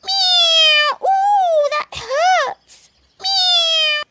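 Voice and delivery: high, loud squealing kitten in pain; kitten yelp. Acted. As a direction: yowl like a hurt kitten. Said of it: "said Baby Bush Cat".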